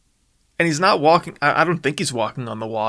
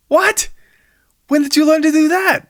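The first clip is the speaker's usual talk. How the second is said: high, loud disbelief; a squeaky shocked outburst; astonished; 5 dB louder than usual. Acted. D J learns his friend can fly, shrieks in disbelief.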